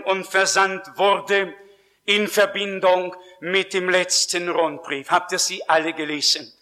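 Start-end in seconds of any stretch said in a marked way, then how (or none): none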